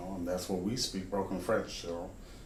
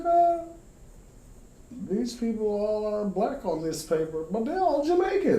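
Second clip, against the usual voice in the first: high, loud astonished reaction; high-pitched disbelief at the paper; amazed; 13 semitones higher, 5 dB louder than usual. Retold or imitated. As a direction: marvel at the discovery. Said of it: "I'm like".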